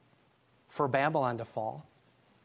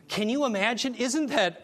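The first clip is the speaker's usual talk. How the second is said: facetious; 7 semitones higher than usual. Acted. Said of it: "I'm being facetious".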